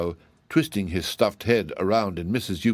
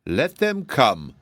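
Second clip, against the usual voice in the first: slow, higher-pitched scorn; scornful; 4 semitones higher, much slower than usual. A narrator's reading. Giantess answers the threat of rescue, scornfully. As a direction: drag it out with disdain. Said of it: "with an accent of scorn".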